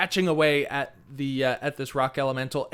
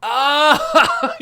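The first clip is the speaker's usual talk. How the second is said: high, loud drawn-out "Ah!"; loud exclamation; 12 semitones higher, 10 dB louder than usual. Real, not acted. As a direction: burst out in sudden recognition. Sudden recognition of the reference.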